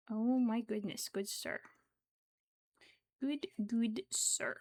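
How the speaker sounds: usual speaking voice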